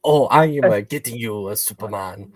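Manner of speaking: badly faked Korean accent; mock Korean accent. Co-host jokes about playing Lex Luthor, mock Korean accent. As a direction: put on a hammy, inaccurate Korean accent. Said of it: "That's not a Korean accent".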